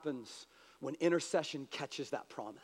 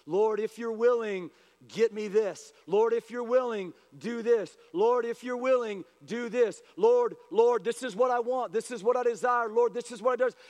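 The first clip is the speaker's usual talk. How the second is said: raised, insistent repeated plea to God; crying out; 4 semitones higher, 4 dB louder than usual. Retold or imitated. Believers in their gardens praying to the Lord, crying out. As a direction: beg in short, pressing bursts, higher pitched. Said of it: "we're crying out to the Lord".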